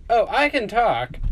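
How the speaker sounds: high, loud indignant character voice; talking bomb. Acted. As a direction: protest loudly in a high voice.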